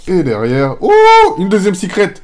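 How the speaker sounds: very high, loud cry of surprise; real overjoyed scream; ecstatic shout. Real, not acted. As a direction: yell out the secret rare in disbelief. Second secret rare pulled.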